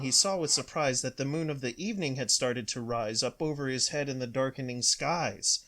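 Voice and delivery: usual speaking voice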